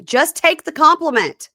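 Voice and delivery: high, loud, exasperated outburst; exasperated retort. Retold or imitated. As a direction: burst out as if fed up. Exasperated at her begging for praise.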